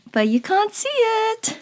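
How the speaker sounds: high, loud teasing lilt; playful sing-song. Real, not acted. Teasing viewers about her hidden pyjamas.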